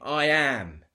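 loud, ringing proclamation; commanding declaration. Retold or imitated. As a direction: declare it with raw authority. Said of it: "when Jesus declares his name".

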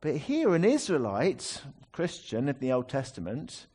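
ordinary talk

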